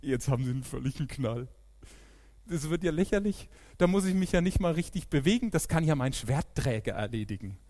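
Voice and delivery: lauter, höhnischer Riese; spöttischer Goliath. Retold or imitated. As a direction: mach dich laut über den kleinen Jungen lustig. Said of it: "dass er sagt". (Goliath, lauter und spöttisch)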